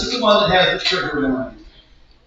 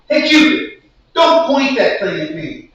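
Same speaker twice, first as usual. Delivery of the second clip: exasperated complaint, pitched up and punchy; comic performance poem; 7 semitones higher, 6 dB louder than usual. Acted. Tired lover scolds Cupid for aiming at him, exasperated. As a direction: scold like a fed-up spoken-word poet.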